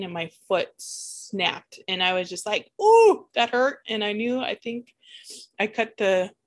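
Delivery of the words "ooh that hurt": sudden pained yelp, higher and louder; retold ouch. Retelling to viewers a foot pain, sudden pained yelp.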